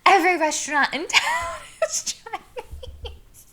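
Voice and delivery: high, slow, breaking into a laugh; laughing through it. Retold or imitated. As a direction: laugh as you say it. Quoting waitress, laughing mid-line.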